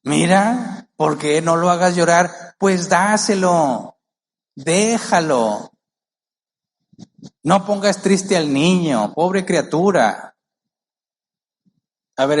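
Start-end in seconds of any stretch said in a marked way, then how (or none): 0.0-10.3 higher, drawn-out pitying grandma voice; pleading grandmother. Acted. Grandmothers begging a parent to give in, pitying mock plea. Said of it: "dicen las abuelitas".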